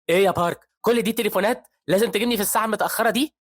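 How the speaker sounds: high, loud exasperated snap; irritated complaint. A narrator's reading. Professor complaining to Park about the late calls, loud. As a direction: vent annoyance at being summoned.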